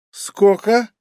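tongue-tied tipsy ask; slurred drunk. Acted. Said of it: "заплетающимся языком".

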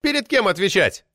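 high, sceptical query; scoffing. A narrator's reading. Member challenging the chairman on responsibility, scoffing. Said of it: "хмыкнул Флагелянский".

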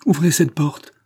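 loud, high command; ordre impérieux. Acted. Elle exige de sortir. (ordre impérieux, voix haute et forte)

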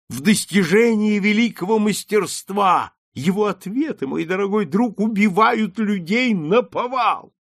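the lord raves about the great wit; ecstatic praise. A narrator's reading. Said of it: "в каком-то экстазе". (восторженно, выше и громче)

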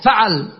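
فعل with a after f and after ʕ; a on both. Shown as a demonstration. Teacher showing students the next pattern, "faʕal". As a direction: say فعل as "faʕal", two short a's. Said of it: "فتح الفاء مع فتح العين".

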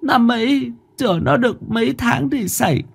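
high mother's voice, tearful confession; grieving mother. Acted. Narrator voices Bà Thủy.

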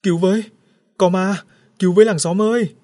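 high, loud terrified cries; screaming for help. A narrator's reading. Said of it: "miệng kêu cứu vang trời". (tiếng kêu cứu hoảng loạn)

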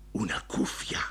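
hushed unvoiced whisper; whispered in awe. A narrator's reading. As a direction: breathe it like in church. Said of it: "Er flüsterte dabei".

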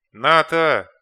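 loud desperate yell; yelling a name. A narrator's reading. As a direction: scream her name across the gap. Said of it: "заорал командир". (отчаянный крик)

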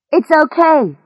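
understated shout of reassurance; calling out. A narrator's reading. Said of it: "she shouted".

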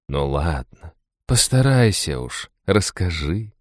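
slow, sultry coaxing; languid chest voice. A narrator's reading. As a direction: coax him languidly from the chest. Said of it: "грудным томным голосом".

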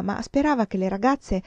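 plain reading voice

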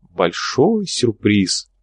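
drawn-out, savoring each word; slow teasing. Acted. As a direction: tease the surprise slowly. (медленно, с предвкушением)

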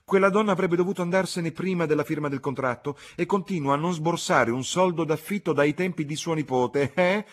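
loud, high, triumphant tirade; outraged matron demanding agreement; indignant outburst. Acted. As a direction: rail away at full voice. Indignant woman rants about tenant.

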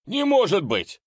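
high, loud cry of shock. Acted. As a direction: gasp it out in disbelief. Shocked at tentacles appearing.

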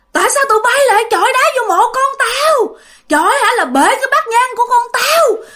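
high-pitched furious scolding; ghostly woman's angry yelling. Retold or imitated. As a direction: shriek accusations as an enraged old woman. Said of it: "Mà bả la".

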